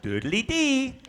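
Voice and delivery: "doodly-dee" lilting high, rising at the end; "doodly-dee" as a cheerful lifting jingle; positive upbeat rhythm. Shown as a demonstration. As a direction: sing "doodly-dee" as a bouncy upward tune. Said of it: "So it's all sort of".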